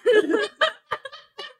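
loud high burst of laughter; big laugh. Real, not acted. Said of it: "I love that laugh".

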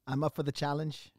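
ordinary talk, nothing marked

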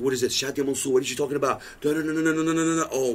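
ordinary delivery

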